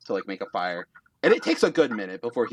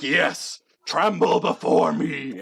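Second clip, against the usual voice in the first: slow, loud, raised villainous boast; gloating giant; 6 semitones higher, 4 dB louder, slower than usual. Acted. Giant to nonplussed judges, slow grandiose gloat.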